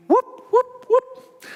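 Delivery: very high whooping rotor noise. Acted. Teacher makes rotor sounds for preschoolers, very high whoops. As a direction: make the rotors sing.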